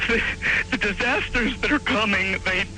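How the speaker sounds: plain reading voice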